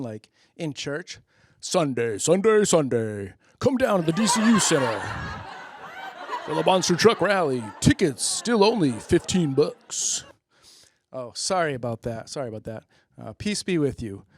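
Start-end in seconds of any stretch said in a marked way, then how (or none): 1.6-10.2 monster truck ad. Acted. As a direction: shout it like a rally promo. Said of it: "it just comes on".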